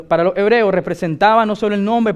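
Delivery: ordinary talk, nothing marked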